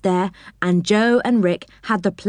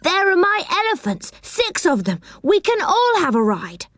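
put-on high-pitched, delighted girlish voice; excited yell; 11 semitones higher than usual. A narrator's reading. Franny claims the elephants for a ride, excitedly. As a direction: exclaim in high, eager excitement. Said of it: "yelled Franny with excitement".

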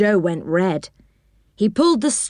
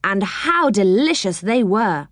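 ordinary delivery

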